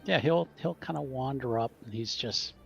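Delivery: ordinary talk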